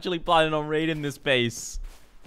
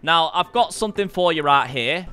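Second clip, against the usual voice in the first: greeting a friend at his base in a bad Yorkshire accent; mock Yorkshire accent. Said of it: "I nailed the Yorkshire accent".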